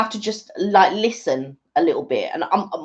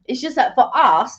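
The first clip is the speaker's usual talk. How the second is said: usual speaking voice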